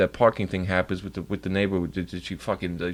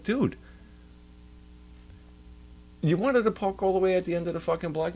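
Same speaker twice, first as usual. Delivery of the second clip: indignant; 8 semitones higher than usual. Retold or imitated. Outraged reply to neighbor.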